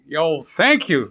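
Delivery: put-on Mother Nature thanking in a playful American accent. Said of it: "why she had to be in an American accent".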